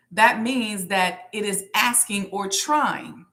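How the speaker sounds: usual speaking voice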